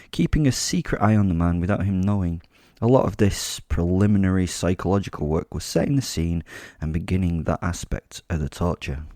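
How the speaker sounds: ordinary delivery, nothing marked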